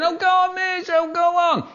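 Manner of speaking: very high pleading whine; whiny kid. Acted. Whiny kid begging the teacher, whining. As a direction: wheedle like a child begging. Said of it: "The whiny ones".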